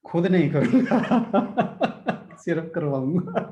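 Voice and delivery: real laughter breaking through the words; amused, laughing between phrases. Real, not acted. Jokes he will only lead it.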